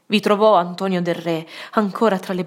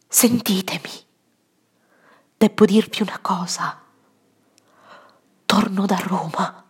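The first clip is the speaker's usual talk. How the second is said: whispering. Acted. Confiding secret to dying woman.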